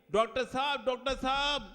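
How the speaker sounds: high, loud anxious call for the doctor; frantic mother calling doctor sahab. Acted. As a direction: call the doctor urgently from the door.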